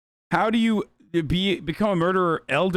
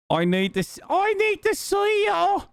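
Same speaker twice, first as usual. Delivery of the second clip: badly faked Aussie accent leaping high; attempted Australian accent. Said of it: "I forgot how to do an Australian accent".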